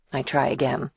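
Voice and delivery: usual speaking voice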